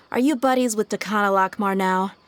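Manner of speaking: normal talking voice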